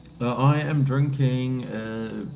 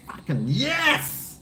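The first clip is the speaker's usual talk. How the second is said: excited; 11 semitones higher, slower than usual. Real, not acted. Excited about recipe idea.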